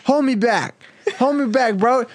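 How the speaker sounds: high, hammed-up tough guy; macho bro bravado. Bro hero squaring up to the shark, high hammy bravado. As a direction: puff up like a cocky bro.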